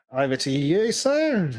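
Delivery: ordinary talk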